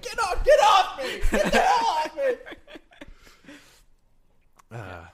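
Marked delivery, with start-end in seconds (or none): 0.0-2.3 very high, full-volume pretend screaming; mock struggle yell. Ousted co-host fighting off the guys removing him, mock screaming. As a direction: scream as if fighting off bouncers, playfully.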